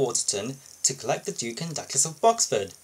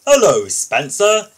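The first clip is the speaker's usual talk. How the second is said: smug greeting; 7 semitones higher, 11 dB louder than usual. Acted. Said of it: "whistled Gordon".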